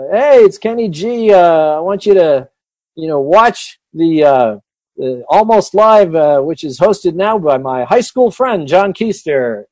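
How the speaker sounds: loud, slow promo delivery. Retold or imitated. Retold [name] promo for show.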